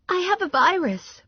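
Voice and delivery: very high, defensive woman; high-pitched excuse-making; defensive Valerie. A narrator's reading. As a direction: protest in a high little voice. Narrator voices defensive Valerie.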